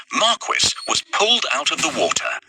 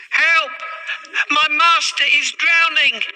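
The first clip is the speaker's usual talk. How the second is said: frantic high wail for help; wailing cry for help. A narrator's reading. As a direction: cry out as if your master is drowning. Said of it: "Puss wailed".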